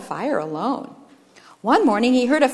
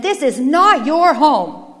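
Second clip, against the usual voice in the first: higher, slow, hammered words; forceful, raised rejection; loud demand; 5 semitones higher, 8 dB louder, slower than usual. A narrator's reading. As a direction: bar the intruder with each word. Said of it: "they demanded".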